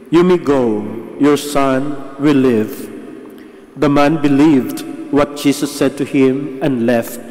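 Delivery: usual speaking voice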